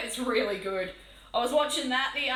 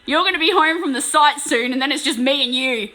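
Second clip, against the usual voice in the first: loud playful taunting; 7 dB louder than usual. Real, not acted. Teasing the chat about the paint, loud and mock-menacing. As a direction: ham up a villain's threat to the chat.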